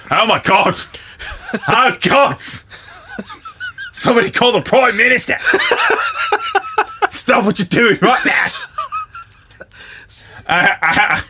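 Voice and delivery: high, loud, laughing Aussie panic; hammed-up Australian yelling through laughter; Australian accent, excited shouting. Reviewer discovers the prostitute kill, shouting in a hammy Australian accent. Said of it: "I gotta do a shitty Australian accent".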